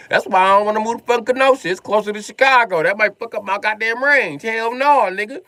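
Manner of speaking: high, loud comic outrage; indignant rant. Acted. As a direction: refuse loudly with the pitch way up. Comic rant against moving closer.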